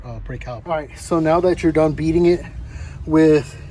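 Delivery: ordinary delivery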